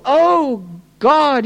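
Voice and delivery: high, drawn-out cry to heaven; desperate prayer. Acted. Preacher voicing the prophet pleading for his people, desperate. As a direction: call out to God from an overwhelmed heart. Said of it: "the pungency of his, of his prayers".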